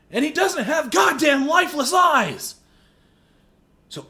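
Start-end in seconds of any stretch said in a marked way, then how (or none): 0.1-2.5 higher, louder furious yell; angry shout. Real, not acted. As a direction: blast it at the actor. Shouted rant.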